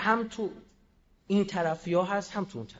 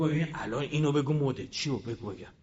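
lower, dull-witted parroting voice; mocking the MP; 9 semitones lower, 7 dB quieter than usual. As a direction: play a man who repeats whatever he's told. (تقلید تمسخرآمیز نماینده با صدای بم و گنگ)

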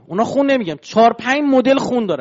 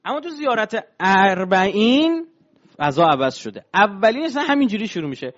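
usual speaking voice

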